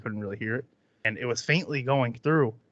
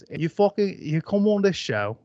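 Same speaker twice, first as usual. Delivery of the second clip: put-on lightly Australian-accented talent judge, pitched up; botched Australian accent; 7 semitones higher than usual. Judge scolding a contestant on the show, botched Australian accent. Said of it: "I think I made him Australian".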